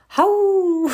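high playful wolf howl. Pretend. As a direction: throw your head back and howl. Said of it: "I don't know how to make a wolf noise".

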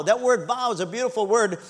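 normal talking voice

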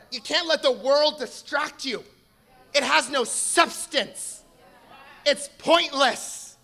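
high, loud, slowed preaching; full-force preaching. Acted. Preacher hammers the point home to the congregation, high and loud. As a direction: preach it up and slow.

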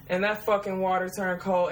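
ordinary talk, nothing marked

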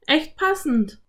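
put-on high girl voice, smiling; a smiling little girl answering; Anne, smiling. A narrator's reading. (Mädchenstimme, lächelnd)

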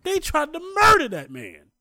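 loud, high-pitched indignation; real outrage. Real, not acted. Man telling co-host about police shooting a caregiver, outraged. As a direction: burst out loudly in outrage, pitch jumping high.

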